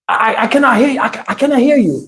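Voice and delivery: stammering mock Chinese accent. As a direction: mimic a confused man with a Chinese accent. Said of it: "I heard the guy's accent".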